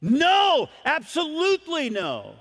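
no, no shouted high and loud; preacher acting out an outraged no; emphatic refusal. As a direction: refuse with everything you have. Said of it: "where you would say".

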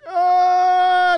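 high, long siren-like wail; sustained mock infant bawl; imitated baby crying. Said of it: "they start crying".

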